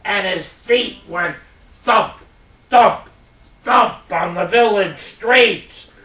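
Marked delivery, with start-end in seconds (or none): 1.8-3.1 loud, punched, separate thumps; heavy footsteps. A narrator's reading. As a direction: stamp out each thump like a giant's step. Imitating giant footsteps.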